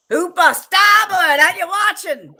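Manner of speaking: a captain yelling to Hooper; shouted order. Retold or imitated. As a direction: call out across the boat. Quoting a shouted order on a boat.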